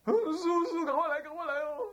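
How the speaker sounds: high, loud mock-panicked call; urgent plea. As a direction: summon the master in high-pitched alarm. Wife's urgent phone call to master. (模仿妻子高声急呼师父)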